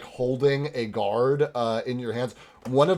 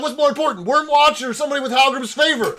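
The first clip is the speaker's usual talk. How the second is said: guard voice; 13 semitones higher, 7 dB louder, faster than usual. Acted.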